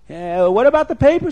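urgent acted call; calling after her. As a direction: act out a flustered man calling after someone running off. Retold man calls after fleeing woman.

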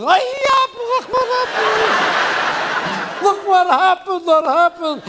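shrill high panicked wife, slow and loud; wife hollering over a flat tire, high and loud. Acted. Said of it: "The wife immediately starts hollering".

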